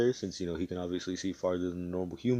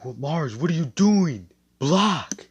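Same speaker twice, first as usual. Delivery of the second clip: yelled in panic, higher and louder; alarmed shout; 8 semitones higher, 8 dB louder than usual. Acted. Fonzel shouting at Mars to block the fireballs, panicked yell. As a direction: scream the warning across the battlefield.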